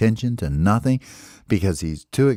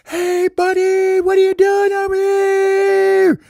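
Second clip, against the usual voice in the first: high, loud, slow long-distance call; yelling across forest; 6 dB louder, slower than usual. Acted. Baby bird yelling to his friend across the forest, loud. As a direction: call out to be heard far off. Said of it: "Baby bird yells all the way across".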